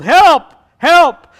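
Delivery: high, loud comic cry; yelling for help. Acted. Jack seeking anyone else up there, yelling for help. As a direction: shout past God for another rescuer. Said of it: "Finally, Jack yelled".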